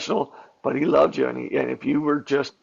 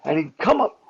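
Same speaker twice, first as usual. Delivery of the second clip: higher, acted-out voice of the grandfather; showing the move; 6 semitones higher than usual. Shown as a demonstration. Actor demonstrating grandfather's style, a lightly voiced performance. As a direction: perform it as the old fighter would. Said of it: "And I show him".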